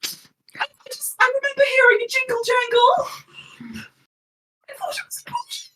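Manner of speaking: high, whispery then squeaky excuse; Kev sheepish. Acted. Kev explains the jingling keys to the prince, high sheepish squeak.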